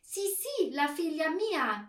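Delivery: loud, high Italian reply; delighted confirmation. A narrator's reading. Herr Marconi confirming it is his daughter, delighted. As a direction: answer eagerly, pitched well up.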